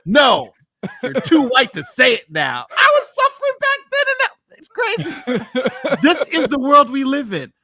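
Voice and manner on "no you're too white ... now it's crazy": laughing. Real, not acted. Laughing rant about Plessy.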